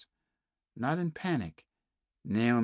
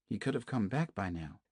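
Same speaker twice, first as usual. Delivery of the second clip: ordinary delivery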